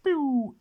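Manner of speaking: loud, high pew; playful laser-shot noise; imitated pew. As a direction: shoot out a high, punchy pew.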